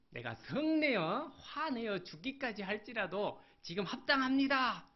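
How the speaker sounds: rising to a high, loud shout; angry shout back. Acted. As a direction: yell it at God in a rage. Preacher voices Jonah shouting back. (하나님께 소리치는 요나)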